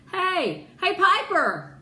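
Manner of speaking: high, loud call across the snow. Acted. Ree calls to Piper across the snow, loud high call. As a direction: shout a friend's name from a distance.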